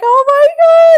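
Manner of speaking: high, loud squealed oh my God; emotional squeal. Retold or imitated. Mum remembering her son's first smile, high emotional squeal. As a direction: squeal it like a mum overcome with feeling. Mum reacting to outfit memory.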